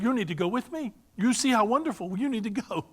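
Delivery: imitated young woman. Young Ghanaian woman urging her coworker to go, lightly imitated.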